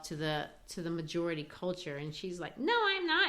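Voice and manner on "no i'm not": put-on much higher, indignant young woman's voice; indignant young woman denying it; Beneatha's denial. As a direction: snap back a quick denial.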